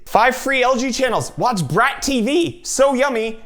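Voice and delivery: high, loud, drawn-out gushing; mock-excited readout. Reading TV channel names to the camera, mock excited. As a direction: gush over each channel name, high and loud.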